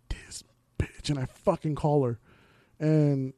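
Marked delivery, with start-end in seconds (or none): 0.1-1.1 hissed whisper under the breath; suspicious whisper. Retold or imitated. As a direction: whisper it like you caught her. Retold suspicion of girlfriend.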